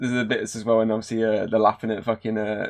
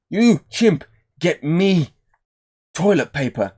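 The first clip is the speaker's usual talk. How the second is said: mock character order; 7 semitones higher, 4 dB louder, slower than usual. Acting a character ordering a chimp.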